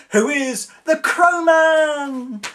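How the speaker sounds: high, loud, slow announcement; excited shout. Real, not acted. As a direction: proclaim it like a thrilled fan. Excited naming fan favourite.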